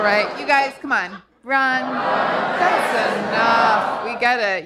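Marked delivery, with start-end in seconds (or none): 1.4-4.0 shouted catchphrase. Pretend. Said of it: "catchphrase called out again".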